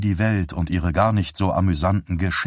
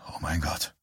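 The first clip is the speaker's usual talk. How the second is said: much softer, barely voiced; hushed stunned repeat; 3 semitones lower, 10 dB quieter than usual. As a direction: numb husband repeating it to himself, hushed. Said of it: "wiederholte er".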